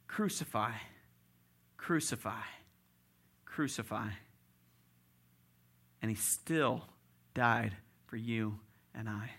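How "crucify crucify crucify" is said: ordinary delivery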